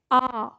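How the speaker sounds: आ as a long, open vowel; repeat-after-me model. Shown as a demonstration. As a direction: model a slow, held आ for learners. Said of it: "एक बार मेरे साथ आप दोहरा लीजिए". (आ का लंबा, खुला उच्चारण)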